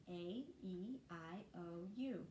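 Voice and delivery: long vowels A, E, I, O, U said apart, each drawn out; long vowel sounds. Shown as a demonstration. Teacher models long vowel sounds for parents, each one clear. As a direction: say each letter name clearly. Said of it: "when you say the long vowel sounds".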